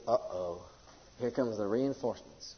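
low, hushed comic villain; hushed uh-oh, as if spotting trouble; worried fever. Acted. Fever spotting God's reinforcements arriving, hushed comic alarm. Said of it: "And the fever looks around. He says".

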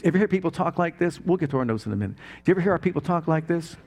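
ordinary talk

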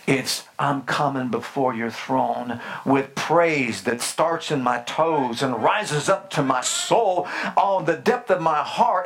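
usual speaking voice

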